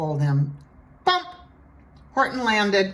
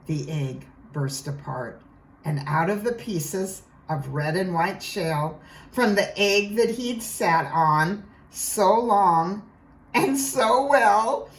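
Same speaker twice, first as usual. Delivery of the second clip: ordinary delivery, nothing marked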